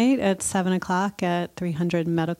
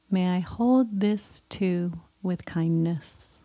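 guided meditation; 2 semitones higher than usual. Acted. Leads a loving kindness meditation.

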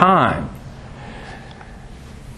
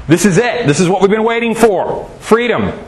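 higher, eager burst of freedom; excited cheer; 7 semitones higher than usual. Acted. Israelites leaving Egypt cheer their freedom, excited and higher. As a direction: voice the Israelites' giddy relief at leaving.

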